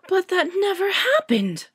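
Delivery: high, loud indignant outburst; shocked young witch railing. A narrator's reading. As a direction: rail against the news. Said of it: "Hermione railed".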